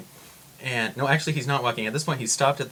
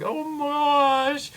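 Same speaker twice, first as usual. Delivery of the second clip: very high, stretched-out squeal; mock dismay; 4 dB louder, much slower than usual. Reacting to the stranger babbling at her, drawn-out squeal. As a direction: wail it slowly in put-on alarm.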